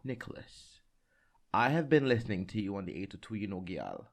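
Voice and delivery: imitated hairdresser in strong Jamaican British accent. Hairdresser telling her client she's been listening, imitated Jamaican British accent. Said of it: "in her very strong, like, Jamaican British accent".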